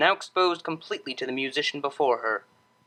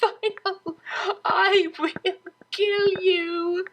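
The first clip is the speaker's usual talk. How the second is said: much higher, laughing mock threat; much slower than usual. Cellist threatening the tickler, high and laughing. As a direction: threaten through the giggles.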